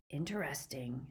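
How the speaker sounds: hushed, suspicious "Interesting"; ominous musing. A narrator's reading. Greyback grows suspicious.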